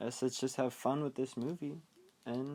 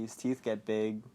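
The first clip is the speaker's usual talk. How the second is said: ordinary talk, nothing marked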